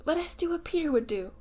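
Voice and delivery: put-on rally the boys together; rallying cry. A narrator's reading. Lost boys rallying each other to face wolves, excited. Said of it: "And then".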